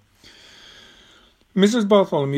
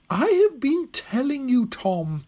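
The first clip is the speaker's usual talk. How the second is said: put-on high, patient old lady; patient old woman; 13 semitones higher, slower than usual. A narrator's reading. Old woman who has been telling Tom already, patient. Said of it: "said Missus Bartholomew patiently".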